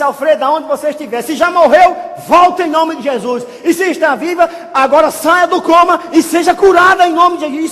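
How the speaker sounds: loud, high preacher's shout; shouted prayer command. Retold or imitated. Preacher prays over the absent woman in a coma, shouting. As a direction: command it at full force for everyone to hear. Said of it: "Isso gritando pra todo mundo ouvir".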